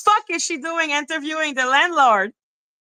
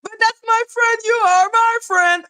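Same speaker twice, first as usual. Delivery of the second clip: mocking Lady Letitia; 7 semitones higher, 3 dB louder than usual. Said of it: "Lady Letitia".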